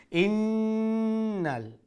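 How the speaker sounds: ইন্নাল with a long, high hummed n; held ghunnah. Shown as a demonstration. Teacher demonstrating ghunnah to students, long nasal hum in ইন্নাল. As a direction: hold the doubled n of ইন্নাল through the nose. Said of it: "আকমাল গুন্না".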